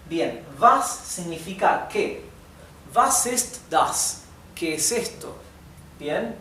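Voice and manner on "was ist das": Was ist das? said slowly and clearly; slow model sentence. Shown as a demonstration. Teacher slowly models Was ist das? for the class. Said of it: "model sentence for students".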